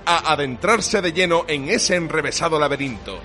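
dramatic trailer narration; epic announcer. Acted. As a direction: tell it like a grand legend. Epic mock-heroic show intro. (narrador épico de tráiler)

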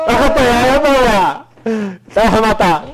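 high, slow urgent call; alarmed warning. Real, not acted. Host urging his friend off the box. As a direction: call him down before he falls.

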